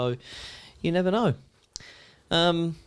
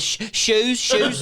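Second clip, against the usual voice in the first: higher, lightly voiced daydreaming Jen; dreamy Jen; 7 semitones higher, much slower than usual. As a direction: drift off thinking of shoes. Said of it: "She goes".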